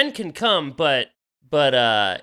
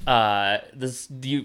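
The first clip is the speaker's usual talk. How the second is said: ordinary talk, nothing marked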